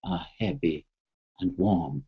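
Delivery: slow, low and soft, like a calming mantra; drawn-out soothing self-talk. Shown as a demonstration. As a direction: let each word sink in slowly. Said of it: "you say".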